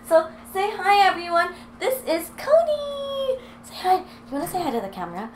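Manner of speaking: stretched-out sing-song pet greeting. Real, not acted. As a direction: present the pup with a cooing voice. Showing dog to camera.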